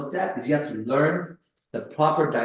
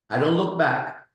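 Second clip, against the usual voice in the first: ordinary talk, nothing marked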